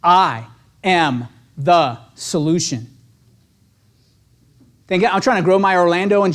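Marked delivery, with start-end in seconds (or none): 0.0-2.9 slow, low and deliberate; affirmation. Shown as a demonstration. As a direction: say it slowly like a mantra. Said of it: "Write that one down".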